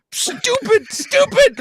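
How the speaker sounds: very high, loud frustrated yelps; hammed-up angry self-reproach; self-berating. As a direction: scold yourself in exasperation. Berating himself after botched audition.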